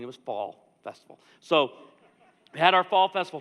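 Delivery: ordinary talk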